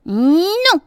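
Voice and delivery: high, loud, curt little creature. A narrator's reading. Flea in the bed answers the bee, curt little voice. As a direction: answer sharply in a tiny voice. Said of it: "dit sèchement une petite voix".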